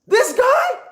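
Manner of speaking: high, incredulous exclamation; acted-out amazed reaction; mock disbelief. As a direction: pitch shoots up in comic astonishment. Acting out disbelief at the voice.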